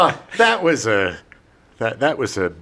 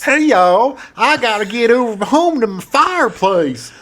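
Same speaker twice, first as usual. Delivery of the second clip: put-on a thick Southern accent, a bit higher; 6 semitones higher, 3 dB louder than usual. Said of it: "her thick Southern accent".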